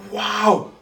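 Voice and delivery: loud, high wows of wonder; eyes just opened on something astonishing; gasping awe. Retold or imitated. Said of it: "he gasped. He went".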